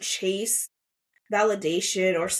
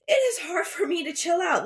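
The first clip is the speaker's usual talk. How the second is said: high, cracking on the edge of tears; voice breaking; 10 semitones higher than usual. Real, not acted. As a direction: let the voice crack with emotion. Admits struggle, voice cracks.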